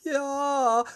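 high, held call; calling back. A narrator's reading. Peter answering through the tunnel, high calling. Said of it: "rief Peter".

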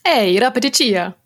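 "Hey, Rapeticia" in Sims-style fake Italian. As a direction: greet like a Sim in pretend Italian. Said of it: "das war tatsächlich das Fake-Italienisch von Die Sims zwei".

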